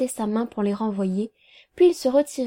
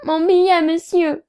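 high, louder pleading, a touch tearful; tearful plea; 6 semitones higher, 5 dB louder than usual. A narrator's reading. Weeping woman pleading to the lawyer about her bill, tearful. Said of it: "fit la femme qui pleurait".

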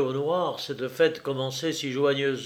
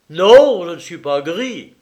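a loud cry of no, then firm; sharp denial; 4 semitones higher, 13 dB louder than usual. Acted. Jacques denying he is drunk, loud sharp no. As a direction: cut her off hard. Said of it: "s'écria le jeune homme".

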